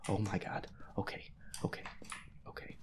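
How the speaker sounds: usual speaking voice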